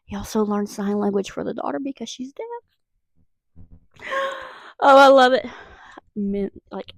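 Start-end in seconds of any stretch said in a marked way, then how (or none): 4.0-4.8 excited gasp. Real, not acted. Excited gasp over book. 4.8-5.5 excited. Real, not acted. Gushing about romance book.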